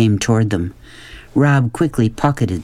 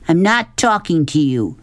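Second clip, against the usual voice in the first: restrained shout back; 6 semitones higher than usual. A narrator's reading. Sistine shouting back at Rob, restrained high retort. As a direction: shout back with the volume held in. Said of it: "she shouted back".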